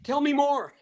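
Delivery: high, squeaky, laughing plea from the floor; high-pitched mock-panicked politeness; flustered pleading. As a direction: play the flustered victim begging politely. Pinned man pleading with attacker.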